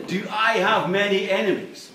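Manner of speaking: loud, scornful repeat of the question; mock the question; jeering echo; ribald mockery. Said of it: "for the most ribald mockery".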